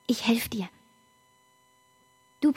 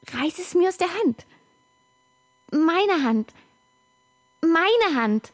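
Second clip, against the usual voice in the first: urgent; 7 semitones higher, 4 dB louder than usual. Acted. Insisting, taunting with hand.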